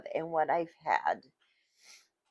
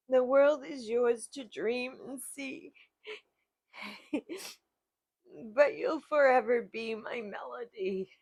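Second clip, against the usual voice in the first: a verse read on the edge of crying, breaking into laughs; tearful reading; 5 semitones higher than usual. Real, not acted. Reading her mom's poem aloud, crying between laughs. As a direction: fight the tears and keep reading. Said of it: "Oh, I'm sorry".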